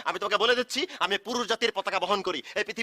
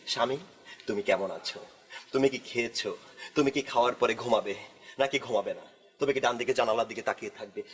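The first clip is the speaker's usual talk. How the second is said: meek devoted wife; 12 semitones lower than usual. Pretend. Man mimicking his ideal obedient wife, sweet and meek. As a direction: sweetly coo like an obedient wife asking after him. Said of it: "নম্র স্বরে কথা বলে আমাকে জিজ্ঞেস করবে".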